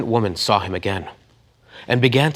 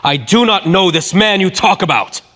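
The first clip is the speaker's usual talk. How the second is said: much higher, louder angry denial; swearing denial; 10 semitones higher, 8 dB louder than usual. Acted. Peter denying Jesus to bystanders, angry shout. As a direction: curse and swear it off. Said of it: "began to curse and swear".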